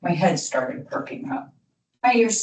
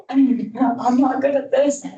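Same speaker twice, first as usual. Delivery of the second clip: high, slow, laughing admission; laughing at herself; 7 semitones higher, slower than usual. Real, not acted. Speaker admitting she struggles too: laughing, self-mocking. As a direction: laugh it off as you confess.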